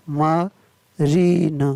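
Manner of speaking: Marine stretched very slowly, syllable by syllable; teacher slows marine right down for students to copy; drawn-out model word. Shown as a demonstration. Teacher models marine very slowly for the class. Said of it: "M A R I N E".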